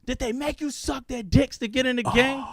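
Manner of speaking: high-pitched imitation of mother's question; interrogating mom. Mom questioning son about the gang, high indignant imitation. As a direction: mimic a mom's pointed, high demand. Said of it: "My mom goes".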